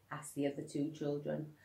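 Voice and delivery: usual speaking voice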